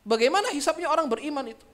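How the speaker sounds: lightly voiced Aisyah questioning the Prophet; mild protesting question to the Prophet, voice lifted. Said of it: "Sayyidatu Aisyah protes kepada Rasulullah".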